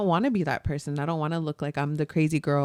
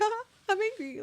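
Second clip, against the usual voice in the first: much higher and softer, cheerful; happy angry princess; 14 semitones higher, 8 dB quieter, faster than usual. Retold or imitated. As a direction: chirp it high and happy like a princess. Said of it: "and then she's all happy".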